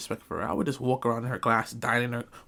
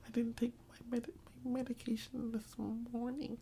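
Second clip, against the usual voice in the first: breathy whispered confession, higher; mock whisper; 8 semitones higher, 14 dB quieter than usual. Telling the teacher he skipped his meds, whispered. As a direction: whisper it like a secret. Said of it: "I was like".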